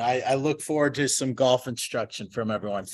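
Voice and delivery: normal talking voice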